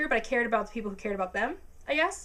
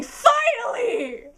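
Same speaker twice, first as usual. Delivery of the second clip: high, slow, loud drawn-out yell; screaming; 13 semitones higher, 7 dB louder, much slower than usual. Retold or imitated. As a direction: scream it in triumph. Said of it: "I started screaming".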